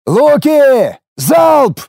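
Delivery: high, loud battle command; shout. A narrator's reading. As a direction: bark the volley order. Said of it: "кричу я".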